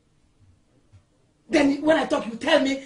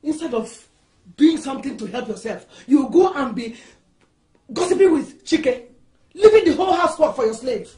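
loud, raised-pitch reproach, building; indignant scolding; 5 dB louder than usual. Acted. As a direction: scold a daughter-in-law forcefully. Mother-in-law scolds the wife.

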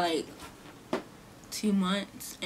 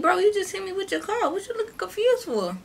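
high, incredulous "what you looking confused for?"; indignant disbelief; 10 semitones higher than usual. Retold or imitated. Girl indignant at the driver who hit her. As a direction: call him out, exasperated.

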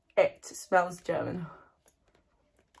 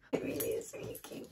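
barely voiced, choked up; hushed and overwhelmed; overcome whisper; 6 dB quieter than usual. Real, not acted. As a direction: whisper it, too moved to speak. Moved to tears over the box.

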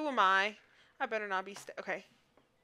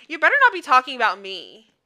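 ordinary delivery

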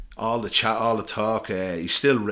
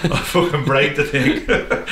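slow and louder, laughing through the words; 6 semitones higher, 8 dB louder, slower than usual. Real, not acted. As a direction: laugh it out at your own joke. Laughing at his own story.

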